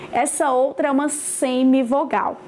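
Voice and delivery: usual speaking voice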